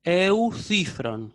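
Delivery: "eucifron" drawn out slowly, e-u-THÜ-fron; careful Greek pronunciation. Shown as a demonstration. Teacher demonstrating the rule, slow careful "eucifron". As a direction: stretch each syllable of "eucifron" very slowly. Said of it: "por eso yo digo".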